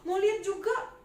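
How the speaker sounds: high, loud eager cry; eager pleading. Acted. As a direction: beg to see over the crowd. Zakius runs to see Jesus.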